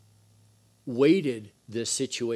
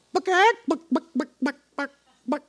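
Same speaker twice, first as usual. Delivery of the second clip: high mock chicken buck-buck; chicken clucking; 13 semitones higher, 6 dB louder than usual. Preacher mocking the cowardly elders, high chicken clucks. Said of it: "They were chicken".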